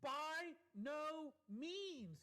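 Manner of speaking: slow, high, every word hit: By no means; drawn-out, weighty By no means; emphatic denial. Acted. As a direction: spell out By no means slowly, with force. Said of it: "he answers it emphatically".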